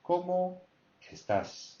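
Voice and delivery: Cómo high, estás dropping with weight on tas; high CÓMO, accent on tas. Shown as a demonstration. Teacher models the greeting, high Cómo and accented tas. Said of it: "tas is where the accent is".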